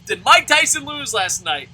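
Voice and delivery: loud mock call to the preacher; loudly hailing the preacher guy. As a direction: shout it across the room. Calling out to the preacher.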